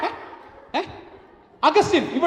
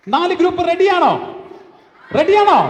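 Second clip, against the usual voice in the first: loud, raised-pitch call to a crowd; rallying call; 4 semitones higher, 4 dB louder than usual. Real, not acted. Host asks the four groups if they are ready, rallying call.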